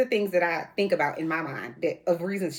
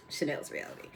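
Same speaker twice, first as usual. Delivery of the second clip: whispered, lower show name; 4 semitones lower, 11 dB quieter than usual. Shown as a demonstration. Host whispers her channel name as a sign-off tag. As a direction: breathe the show title like a tagline.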